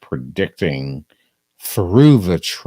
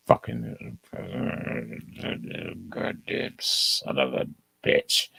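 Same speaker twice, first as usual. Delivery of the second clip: muttered curse; 5 semitones lower, 9 dB quieter than usual. Real, not acted. Muttered curse at search results.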